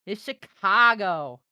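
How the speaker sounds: loud, slow, hammed-up hometown pride; proud declaration. Streamer ending his pizza rant, loud and proud. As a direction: land it like it settles everything.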